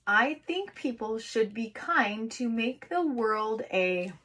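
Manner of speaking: slow, even dictation of the sentence. Shown as a demonstration. Teacher dictating sentence to kids, slow and clear. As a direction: read each word slowly for little writers. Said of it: "See how fast you can write".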